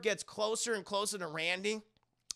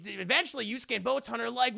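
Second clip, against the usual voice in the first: ordinary delivery, nothing marked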